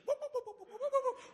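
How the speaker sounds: high, laughing whoop-whoop noises; Three Stooges whoops. Retold or imitated. Mimicking Three Stooges while fleeing up the stairs, whooping. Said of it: "Three Stooges".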